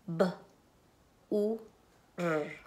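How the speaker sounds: B, U, R, each sound said apart with pauses; phoneme by phoneme. Shown as a demonstration. Teacher to class, segmenting a syllable into phonemes. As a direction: split bur into single sounds, one at a time. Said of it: "decir cada fonema".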